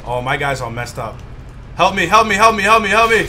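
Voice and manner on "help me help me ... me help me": loud, high frantic pleas; frantic pleading. Pretend. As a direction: beg for help frantically. Pleading in game.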